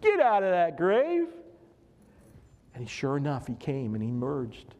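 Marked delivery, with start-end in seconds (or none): 0.0-1.3 imitating a summoning call to someone at a distance. Jesus summons dead Lazarus from the grave, calling out. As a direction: call out a name, pitched high. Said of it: "Jesus called out his name".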